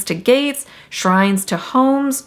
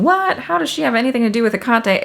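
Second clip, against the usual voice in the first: higher-pitched baffled "What?", then a quick question; puzzled listener; 5 semitones higher, much faster than usual. Acted. Viewer questioning the Aphrodite link, puzzled. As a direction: voice a listener's disbelief, high and quick. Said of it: "you might be thinking".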